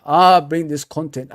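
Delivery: ordinary talk, nothing marked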